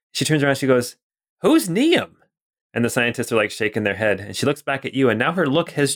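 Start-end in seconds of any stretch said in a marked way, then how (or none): 1.4-2.1 high, pointed question; sharp demand. Acted. Scientist turns to her staff and demands, high and sharp. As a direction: whip round and demand it.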